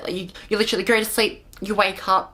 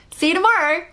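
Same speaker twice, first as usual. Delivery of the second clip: high, chirpy farewell; breezy mock send-off; 8 semitones higher, 4 dB louder, faster than usual. Retelling a quick exit after surgery, breezy and high. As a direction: toss out a quick singsong goodbye.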